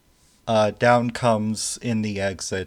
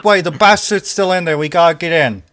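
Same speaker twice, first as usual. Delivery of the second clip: loud, high, fast command; urgent shout; 9 semitones higher, 12 dB louder, faster than usual. Acted. Someone forcing a way back into the building, urgent shouting. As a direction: push through the crowd with your voice.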